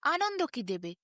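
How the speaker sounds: ordinary delivery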